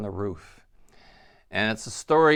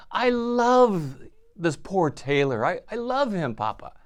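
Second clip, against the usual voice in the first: pleading daughter; 6 semitones higher than usual. Retold or imitated.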